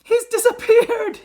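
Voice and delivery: very high, loud panicked yell; horrified mother's scream; Missus Gloop. A narrator's reading. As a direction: shriek it, stretched out. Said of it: "yelled M-, Missus Gloop".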